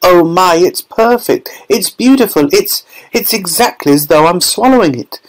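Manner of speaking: high, breathless delight; lost in the taste; rapturous girl. A narrator's reading. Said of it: "shouted Violet".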